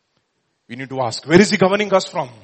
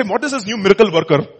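mock wrong version; 4 semitones higher, 5 dB louder, faster than usual. Shown as a demonstration. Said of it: "they didn't say".

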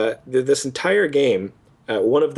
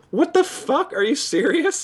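high-pitched mock disbelief; incredulous reaction; 13 semitones higher than usual. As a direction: voice jumps way up in baffled protest. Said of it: "It was like".

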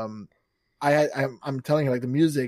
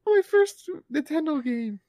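gasp, then slow, high-pitched wonder; retold gasp of delight, drawn out and high; awed excitement; 14 semitones higher than usual. Reviewer recalling getting his first Nintendo game, awed excitement.

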